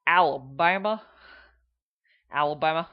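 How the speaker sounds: heavy Alabama accent. Shown as a demonstration. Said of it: "probably the worst, most difficult to understand".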